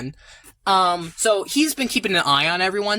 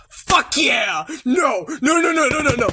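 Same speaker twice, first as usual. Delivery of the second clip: excited yelp, then rapid high no's; real excitement; 6 semitones higher than usual. Real, not acted. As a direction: burst out in excited protest. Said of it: "I'm really excited".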